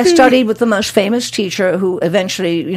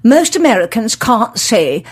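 imitating a haughty elocution teacher; drawn-out, over-enunciated classroom lecture; evil teacher; slower than usual. Speech teacher to the class, haughtily precise. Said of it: "the teachers used to be so evil".

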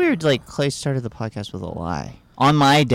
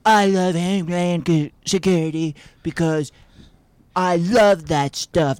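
Donald Trump impression; 6 semitones higher, slower than usual. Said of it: "impression of your dad".

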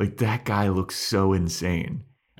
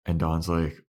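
ordinary talk, nothing marked

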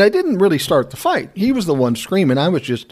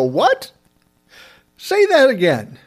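shocked disbelief; 9 semitones higher, slower than usual. Retold or imitated. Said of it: "I go".

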